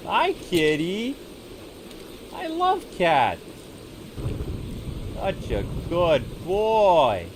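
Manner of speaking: high, slow cooing pet voice. Real, not acted. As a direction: coo at the big cat. Cooing at a tiger.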